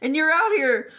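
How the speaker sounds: high, fast frantic rant; high exasperated Zhang Cheng. Retold or imitated. Zhang Cheng ranting at Wei Wuxian about the camp, high and frantic. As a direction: rant at him in a squeaky panic.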